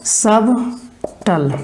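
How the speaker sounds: Subtle drawn out very slowly; slow dictation. Shown as a demonstration. Teacher dictating subtle very slowly. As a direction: stretch subtle for students to write. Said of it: "लिखिएगा subtle".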